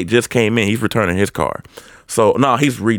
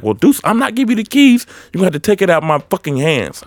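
higher-pitched, mildly heated refusal; retold mad customer; 7 semitones higher than usual. Renter refusing to hand back keys, mock mad. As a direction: imitate an angry customer digging in. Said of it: "getting really, really mad at me".

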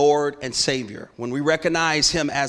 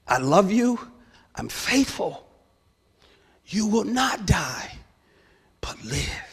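choked voice of God; 6 semitones higher than usual. Retold or imitated. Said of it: "I get choked when I tell this".

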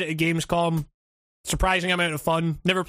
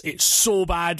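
ordinary talk, nothing marked

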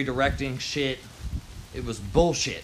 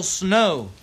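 usual speaking voice

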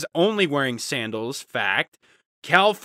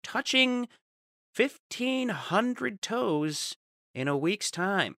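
news anchor; 4 semitones higher, slower than usual. Pretend. Mock news report on toucher.

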